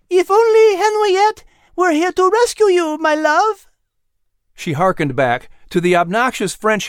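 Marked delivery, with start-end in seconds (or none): 0.1-3.7 loud, very high call; teasing shout. Acted. Liz to Al being chased, high playful shout. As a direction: holler it across the grounds in a high voice. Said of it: "cupped a paw to her mouth and shouted".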